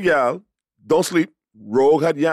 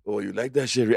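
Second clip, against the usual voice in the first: Gambit line; 6 semitones lower, 4 dB quieter than usual. Retold or imitated. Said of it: "He was like".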